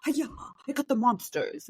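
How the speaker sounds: lightly mimicked kids' karate cries; mock superhero battle cry; kids playing superhero. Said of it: "they think that they're them".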